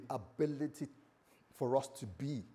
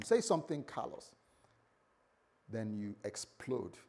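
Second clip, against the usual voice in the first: usual speaking voice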